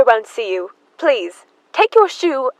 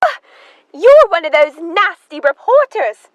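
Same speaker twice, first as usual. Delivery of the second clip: indignant; 5 semitones higher, 7 dB louder than usual. Acted. Emma scolds the reporter.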